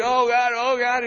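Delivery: high-pitched labour-pain wail, hammed up; mock crying out. Preacher acting Israel as a woman in labour, mock wailing. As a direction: imitate a woman pleading in labour. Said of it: "we're crying all throughout our history".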